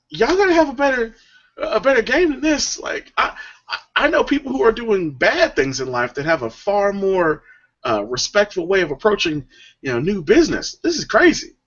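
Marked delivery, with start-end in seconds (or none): 0.1-4.7 exasperated. Real, not acted. Exasperated outburst. 10.8-11.6 exasperated. Real, not acted. Exasperated outburst.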